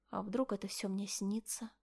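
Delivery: whispered to herself; whispering. A narrator's reading. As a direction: breathe it out as a whisper. Inner thought, whispered.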